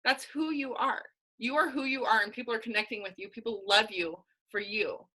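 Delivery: ordinary delivery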